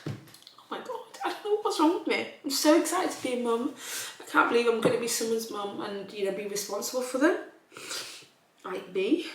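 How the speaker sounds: real happy crying over becoming a mum; tearful. Real, not acted. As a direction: voice cracking with happy emotion. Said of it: "You get all emotional for no reason".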